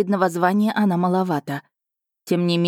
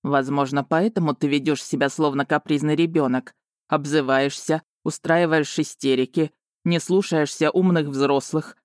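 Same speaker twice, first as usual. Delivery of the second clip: lower, measured dragon character voice; chiding dragon; 3 semitones lower than usual. A narrator's reading. Dragon chides heroine as a capricious child, patronizing. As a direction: list faults with patronizing composure.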